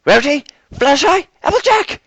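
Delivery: loud, high shouts into darkness; calling out. A narrator's reading. Twilight calls friends in the dark, loud shouts. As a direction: yell for lost friends.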